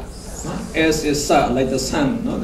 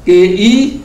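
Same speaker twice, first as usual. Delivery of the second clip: usual speaking voice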